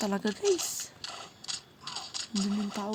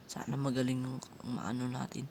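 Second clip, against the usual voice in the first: normal talking voice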